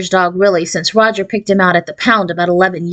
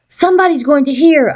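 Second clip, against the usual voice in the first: warning; 6 semitones higher than usual. A narrator's reading. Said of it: "I warned".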